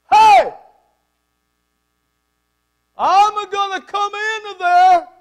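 booming, high, drawn-out Italian shout; irate Italian bellowing through the house; Italian accent yell. Retold or imitated. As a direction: holler it loud and slow with an Italian accent. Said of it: "That's Italian".

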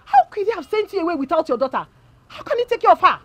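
high, loud indignant questions; outraged on your friend's behalf. Acted. Friend indignant at the husband who sent her away, high and loud.

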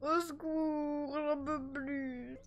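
very slow, drawn-out mock sob; mock sobbing. Fed up with crying babies, mock sobbing. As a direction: wail it out slowly. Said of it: "je pleure".

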